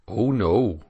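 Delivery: lightly voiced country dairyman; a touch of rustic dairyman in the voice. Dairyman answering the breakfast table, casual rustic voice.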